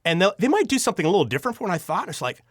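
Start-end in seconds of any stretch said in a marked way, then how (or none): none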